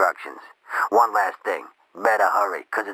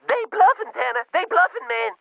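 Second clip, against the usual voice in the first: scared captive. Acted. Captive pleads over phone.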